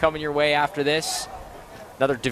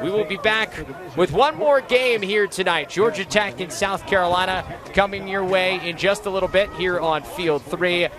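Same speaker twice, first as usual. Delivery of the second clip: raised, energetic final-score call; higher-pitched broadcast announcer wrap-up; sports announcer sign-off; 5 semitones higher than usual. Real, not acted.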